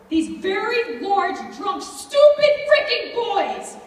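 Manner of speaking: loud, high-pitched, anguished anger; anguished outrage. Acted. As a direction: spit out the outrage at the attackers. Outrage at her attackers.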